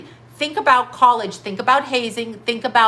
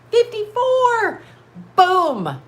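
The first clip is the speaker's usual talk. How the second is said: Fifty-four! drawn out, much higher and slow; excited exclamation; 10 semitones higher, much slower than usual. Real, not acted. Telling her haters her age, crowing it high and slow. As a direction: crow Fifty-four! like a triumphant reveal.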